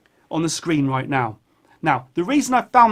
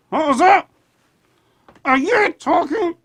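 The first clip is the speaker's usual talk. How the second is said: puppet voice; 11 semitones higher, 4 dB louder than usual. Acted. Talking to a puppet voice.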